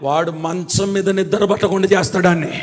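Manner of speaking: normal talking voice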